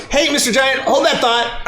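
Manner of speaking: high, cheery voice; Goku's bright high voice; Goku pausing the fight. Acted. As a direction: sing out a polite pause to the giant. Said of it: "And he goes".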